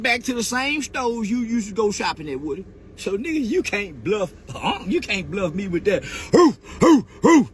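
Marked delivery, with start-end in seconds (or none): 6.0-7.5 loud put-on hoo hoo barks; mock barking. Taunting Woody's barking, mock dog hoots. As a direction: bark like a tough dog to mock a rival. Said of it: "When I hear you barking".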